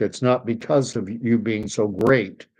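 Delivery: ordinary talk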